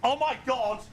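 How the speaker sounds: high startled cry; shocked gasp. Real, not acted. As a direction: blurt it out in sudden shock. Spots a grey hair.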